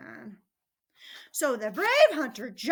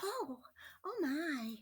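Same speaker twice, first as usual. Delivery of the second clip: put-on soft, high, quickening girl; small shaken voice that starts hurrying; rescued little girl; 3 semitones higher, 9 dB quieter, faster than usual. A narrator's reading.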